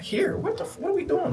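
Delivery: very high, incredulous squeal; alarmed disbelief. Retold or imitated. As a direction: act out baffled panic, pitch shooting up. Retelling his alarmed disbelief.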